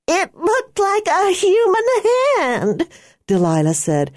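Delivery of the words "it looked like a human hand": higher, panicked shouted line. A narrator's reading. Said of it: "her voice a shout".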